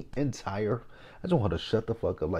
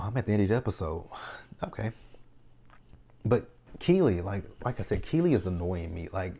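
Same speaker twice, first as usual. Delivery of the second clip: ordinary talk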